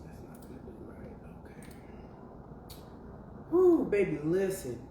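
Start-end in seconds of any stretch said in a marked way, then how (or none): 0.0-1.6 hushed fast aside under her breath; whisper. Real, not acted. Host fixing her setup, whispering a quick aside to herself. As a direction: mutter it quickly to yourself, barely voiced.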